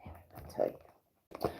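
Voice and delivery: slow hushed whisper. Real, not acted. Reader whispers a confiding aside to her viewers, slow. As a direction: breathe it as a secret aside.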